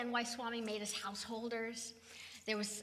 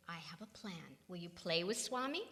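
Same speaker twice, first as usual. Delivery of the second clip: softer, low-key imitation of Swami; hushed Swami voice; 7 semitones lower, 6 dB quieter than usual. Retelling Swami's soft plan, hushed. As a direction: murmur it gently as Swami.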